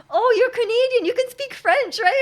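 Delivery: high, loud mock-excited exclamation. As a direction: imitate someone thrilled to find a French speaker. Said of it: "he was, like, really excited".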